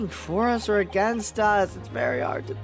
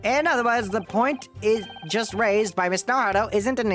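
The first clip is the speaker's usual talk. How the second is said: put-on very old man; 7 semitones higher than usual. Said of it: "the old man voice".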